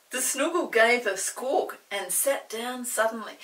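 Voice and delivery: ordinary delivery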